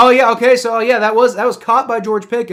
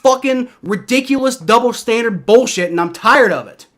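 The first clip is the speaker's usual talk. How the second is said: heated, louder venting about the league; angry rant; 5 semitones higher, 5 dB louder than usual. Real, not acted. Fan ranting at the league over the referees, angry. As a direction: spit out the outrage at the double standard. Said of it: "I'm furious about the N F L right now".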